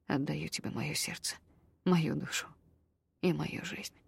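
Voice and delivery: soft, hushed vow; a quiet vow full of tenderness; doomed tenderness. A narrator's reading. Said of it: "обречённая нежность".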